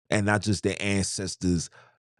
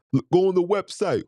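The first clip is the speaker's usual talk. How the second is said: higher mocking voice of a know-it-all; mocking the Eurocentric guy; 12 semitones higher than usual. Mimicking a brother who points to European websites, higher.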